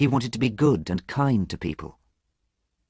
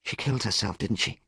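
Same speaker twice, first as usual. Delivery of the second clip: quiet realisation; 6 dB quieter than usual. A narrator's reading. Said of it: "stopped shouting".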